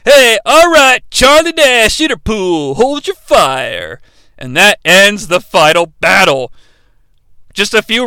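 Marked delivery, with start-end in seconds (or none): 0.0-2.8 mock call out. Nash identifying himself to the cops, mock call. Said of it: "The cops show up". 2.8-4.0 low, very slow command; slow mock command. Nash telling cops to hold fire, slow. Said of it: "The cops show up".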